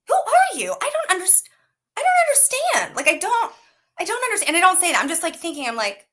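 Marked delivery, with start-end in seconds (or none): 0.1-4.6 high-pitched, exasperated confusion; hammed-up disbelief, pitched way up; mock bafflement. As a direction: plead comically to understand. Acts out her baffled thoughts.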